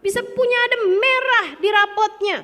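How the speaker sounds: hammed-up incredulous scolding, pitched high; mock angry mother. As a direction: imitate a mother scandalised by a report card. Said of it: "marah banget".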